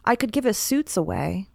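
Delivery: usual speaking voice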